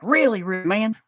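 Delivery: high put-on voice, affirming really really; high insisting. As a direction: insist back in a goofy voice. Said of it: "I'm like".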